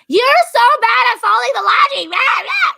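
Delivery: high, loud scolding yell; mock nagging shout. Shown as a demonstration. Wife yelling at husband over laundry, high mock shout. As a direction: berate him over the laundry. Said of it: "Not like".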